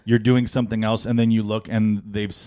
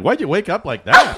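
higher, louder, laughing nag; mock nagging wife; 8 semitones higher, 9 dB louder than usual. Husband imitating wife's constant nagging, laughing mockery. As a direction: mimic the wife's nitpick while laughing. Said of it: "she'd be like".

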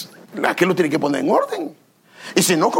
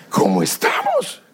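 high, slow question through laughter; laughing mock plea; slower than usual. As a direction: laugh while asking God. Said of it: "Te dije".

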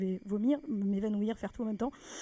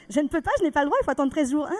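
high, louder mock clerk; mock official; 5 semitones higher, 7 dB louder, faster than usual. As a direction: parody the rigid official. Quoting official refusal. (fonctionnaire imité, aigu)

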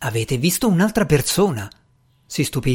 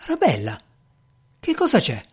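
surprised. Acted. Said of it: "Strange la guardò sorpreso".

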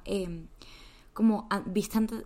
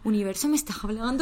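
mock plea to the universe; 3 semitones higher, 8 dB louder than usual. Asking the universe for a sign.

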